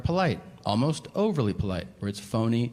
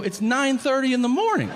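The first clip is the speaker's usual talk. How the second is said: high, loud indignant shout; outraged protest; 14 semitones higher, 5 dB louder than usual. Retold or imitated. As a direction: yell back in disbelief at the doctor. Outraged reply to doctor.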